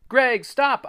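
high, loud plea; pained cry. A narrator's reading. Boy being hit with a stick, crying out. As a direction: cry out for him to stop. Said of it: "I cried".

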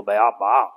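"Baal" in two syllables, bay-AHL, leaning on AHL; stress on second syllable. Shown as a demonstration. Youth leader explaining the name, "Baal" stressed on its second syllable. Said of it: "you would stress that second syllable".

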